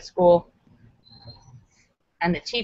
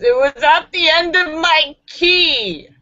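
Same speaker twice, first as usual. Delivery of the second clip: put-on loud, slow drunk voice; drunk man; 7 semitones higher, 5 dB louder, slower than usual. Drunk Edward answering the cop, loud and slow. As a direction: slur it like a confused drunk.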